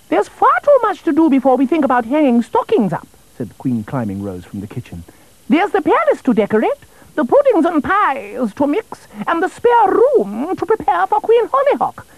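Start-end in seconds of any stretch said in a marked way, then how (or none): 0.1-3.1 much higher, put-upon royal fuss; a busy queen brushing the king off; fussy queen voice. Acted. Queen calling from the kitchen to the king, high and fussy. Queen fusses over Christmas chores. 5.5-12.1 much higher, put-upon royal fuss; a busy queen brushing the king off; fussy queen voice. Acted. Queen calling from the kitchen to the king, high and fussy. Queen lists Christmas chores.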